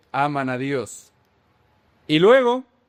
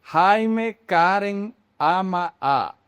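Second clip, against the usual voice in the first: slow word-by-word example; 2 semitones higher, much slower than usual. Shown as a demonstration. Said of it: "Se puede escribir".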